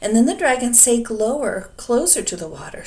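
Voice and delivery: usual speaking voice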